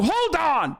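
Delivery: high, mocking voice of an outraged patriot; mocking rioter. Streamer imitates a rioter defending himself, high and mocking. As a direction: parody a rioter claiming his rights. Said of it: "You're like".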